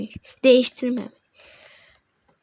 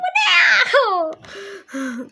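laughing shriek; slower than usual. Real, not acted. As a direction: shriek through laughter in mock panic. Laughing panic at chat.

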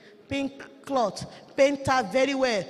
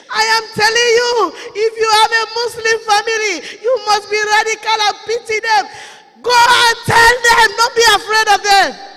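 loud, high, fervent exhortation; preaching at full force; 7 semitones higher, 10 dB louder than usual. Acted. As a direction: urge the church with a shout. Preaching to the congregation.

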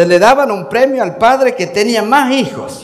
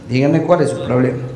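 doctor voice; 9 semitones lower than usual. Acted. Doctor answers patient in joke.

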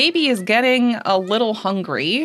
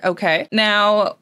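normal talking voice

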